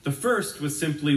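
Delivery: ordinary delivery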